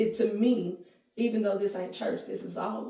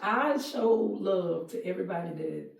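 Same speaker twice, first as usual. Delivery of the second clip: normal talking voice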